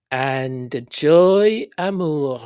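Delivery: a show-off French accent, drawn out. Shown as a demonstration. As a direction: roll it out with a French flair. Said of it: "my accent française".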